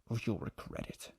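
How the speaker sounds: softly whispered threat; menacing whisper. Retold or imitated. Barry threatening Sisko against the wall, whispered menace. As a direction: hiss the threat close in. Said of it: "He's like".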